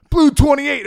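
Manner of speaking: loud high barked play call; retold coach shouting the play; coach's call.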